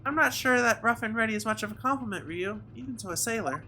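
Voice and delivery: a hint of a prim helper setting someone straight; polite, gently correcting assistant. Voices game assistant.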